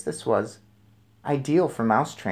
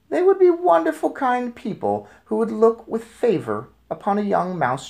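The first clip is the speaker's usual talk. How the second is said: imagined kind voices; 10 semitones higher, 4 dB louder than usual. A narrator's reading. Boy imagines kind people.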